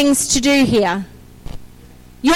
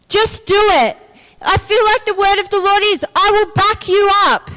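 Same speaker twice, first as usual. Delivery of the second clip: higher, driving preacher's exhortation; emphatic preaching; 5 semitones higher than usual. Real, not acted. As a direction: urge the congregation on, hammering each short phrase. Preaches with force to congregation.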